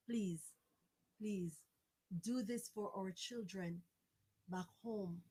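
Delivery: low, soft, spaced-out plea; imploring. Real, not acted. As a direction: beg gently, letting each phrase fall away. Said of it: "begging and imploring us".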